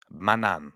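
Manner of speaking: Manan said like my nan; Manan, rhymes with my nan. Shown as a demonstration. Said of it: "Pretty much my nan".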